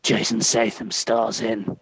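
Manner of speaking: put-on deliberate tough-guy trailer voice in an English accent; Jason Statham. Plugging his next film as guest celebrity, deadpan English hard man. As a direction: play a terse action star, pausing between phrases. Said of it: "we're gonna have Jason Statham sell us on his next film".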